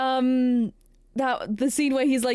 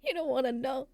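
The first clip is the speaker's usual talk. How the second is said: ordinary talk, nothing marked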